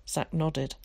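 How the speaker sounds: plain reading voice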